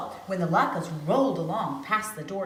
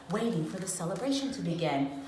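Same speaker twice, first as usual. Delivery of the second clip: ordinary delivery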